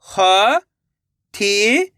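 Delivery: शिकायत stretched out slowly, sound by sound, rising at the end; slow syllable by syllable. Shown as a demonstration. Reading teacher sounding out a word for children, slowly syllable by syllable. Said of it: "मेरे तरीके पे गौर करना है".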